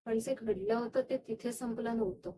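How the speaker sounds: soft, low admission after a long pause; subdued, haunted. Acted. Dreamer admitting the real event didn't end there, subdued. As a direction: let the voice sink, quietly troubled. Hinting at what really happened.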